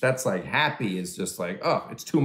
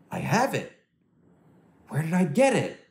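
higher, shocked discovery; mock Mel Gibson realization; 6 semitones higher than usual. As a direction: gasp out the realization. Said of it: "he was like".

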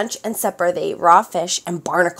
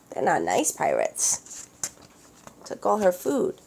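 aside; 4 dB quieter, slower than usual. Real, not acted. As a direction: mutter a sly comment to the listener. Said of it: "reader's aside".

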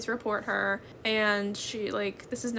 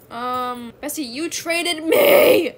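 loud, slow, drawn-out exclaim; excited outburst; 4 semitones higher, 10 dB louder, much slower than usual. Real, not acted. Streamer loudly cries out to her trade partner.

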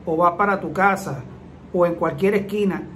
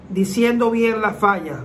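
usual speaking voice

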